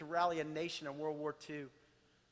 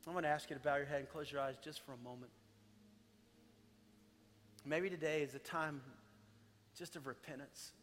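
much softer, intimate prayer over music; hushed altar call; 3 semitones lower, 10 dB quieter than usual. Acted. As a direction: gently guide them into prayer. Leads quiet altar-call prayer.

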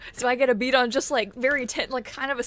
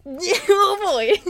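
laughing; 8 semitones higher, 7 dB louder than usual. Real, not acted. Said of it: "manipulation check".

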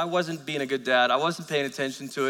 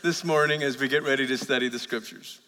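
opening prayer; 3 semitones lower than usual. Acted. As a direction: pray gently over the congregation. Pastor leads opening prayer.

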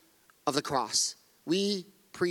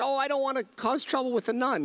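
a hint of a nervous boy, pitched higher; mildly mock the son's reluctance, voice raised; lightly voiced young son; 8 semitones higher than usual. Pastor quoting his son about the nun, lightly imitating a nervous boy. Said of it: "And he said".

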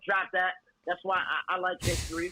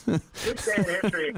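mock announcer; 3 semitones higher than usual. Mock TV segment intro.

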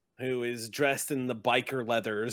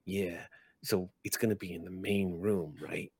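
confiding goblin; 5 semitones lower, 6 dB quieter than usual. Acted. Goblin quietly fishing for information.

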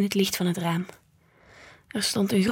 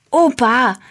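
loud, higher mock-indignant cry; protesting cry; 4 semitones higher, 9 dB louder than usual. A narrator's reading. Granddaughter protesting at grandpa's price, loud cry. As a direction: burst out at grandpa's teasing.

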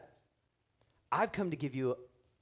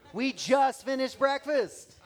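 exasperated parent; 8 semitones higher, 6 dB louder, slower than usual. Retold or imitated. Parent answering whining kids.

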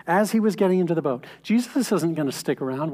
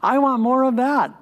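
higher and louder, bursting with longing; eager plea; 8 semitones higher, 5 dB louder than usual. Acted. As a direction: cry it out like he can't hold back. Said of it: "He's basically saying".

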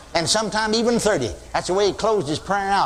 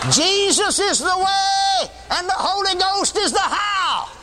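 high, slow, ringing preaching; fervent proclamation; 14 semitones higher, slower than usual. Acted. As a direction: proclaim the answer with full fervor.

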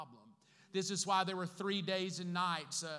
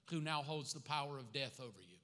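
ordinary talk

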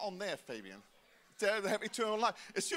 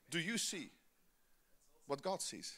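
usual speaking voice

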